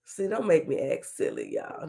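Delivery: low, soft playful warning; mock warning. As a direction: warn them quietly, pitched low. Mock warning, then repeats it higher.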